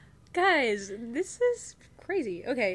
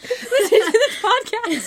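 laughing; 7 semitones higher, 8 dB louder than usual. Real, not acted. Jokes her roommate will hear.

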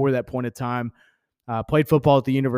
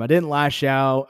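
normal talking voice

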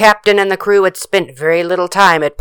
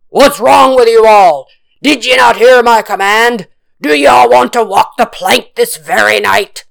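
loud, raised, threatening pirate roar; 5 semitones higher, 6 dB louder than usual. A narrator's reading. Angry captain confronts his crew below deck, roaring. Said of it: "The captain roared into the space".

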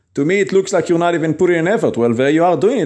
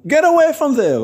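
much higher-pitched mock shout; urgent warning yell; 12 semitones higher than usual. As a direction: call out to pull a child from danger. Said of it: "You yell to save someone".

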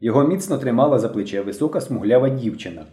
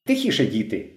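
usual speaking voice

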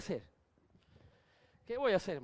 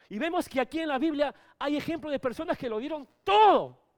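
raised preaching voice; 8 semitones higher, 12 dB louder than usual. Acted.

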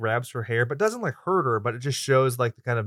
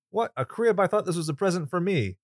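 mock dad joke; 6 semitones higher than usual. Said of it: "cute little joke".

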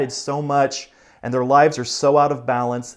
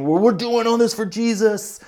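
higher, brighter voice, putting on a brave church face; mock pious excuse; 9 semitones higher, 4 dB louder than usual. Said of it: "and we're just saying".

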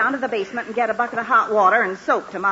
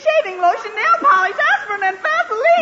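building tearful wail; 12 semitones higher, 5 dB louder than usual. Acted. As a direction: list it off, rising higher and louder toward tears. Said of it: "crying over spilled iodine".

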